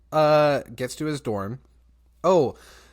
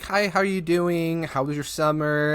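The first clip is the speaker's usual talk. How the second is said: higher-pitched, gushing hello in imitation; overly friendly; 5 semitones higher, 3 dB louder than usual. People greeting the new student at college, overly friendly. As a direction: mimic people being way too friendly. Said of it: "it's really overly friendly".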